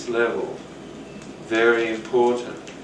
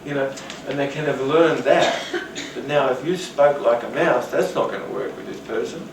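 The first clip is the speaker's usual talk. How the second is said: usual speaking voice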